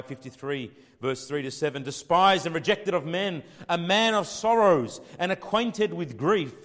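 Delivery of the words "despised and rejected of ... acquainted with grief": loud, slow, high scripture reading; forceful Bible reading; declaimed scripture. Acted. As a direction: proclaim it to the congregation. Scripture read at full force.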